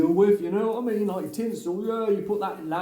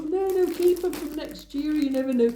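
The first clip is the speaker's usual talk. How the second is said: high mock voice of the wife; imitating wife; 11 semitones higher than usual. Mimicking the wife keeping the gnomes for next year, high. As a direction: put on her voice, pitched well up. Wife's voice quoted, far, high.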